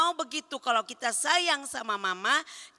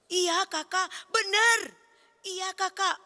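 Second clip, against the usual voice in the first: put-on higher, bright little-kid voice answering; eager child answer; 5 semitones higher than usual. Teacher voicing a child answering Kak Ramsi, eager and higher. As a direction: chirp it back like a small pupil.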